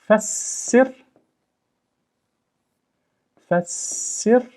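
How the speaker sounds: "Fassir" with a long held s, ending high; doubled s. Shown as a demonstration. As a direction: stretch the s in "Fassir" and lift the last syllable. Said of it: "It should be fassir".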